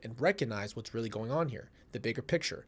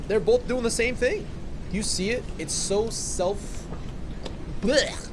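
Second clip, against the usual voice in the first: much higher, louder frustrated outburst; exasperated rant; 8 semitones higher, 4 dB louder, slower than usual. Real, not acted. Venting at both people in the texts, high exasperated yelling. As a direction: throw up your hands and yell it.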